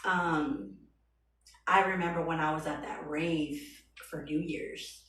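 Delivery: normal talking voice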